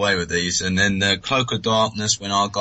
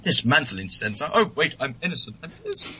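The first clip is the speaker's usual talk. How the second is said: mock panic, climbing much higher to a squeak; imitating a flustered droid protesting; panicky C-3PO; 6 semitones higher than usual. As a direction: flail like a frightened droid, pleading. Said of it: "And he's like".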